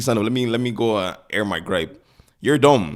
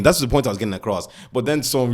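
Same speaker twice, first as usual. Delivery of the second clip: ordinary talk, nothing marked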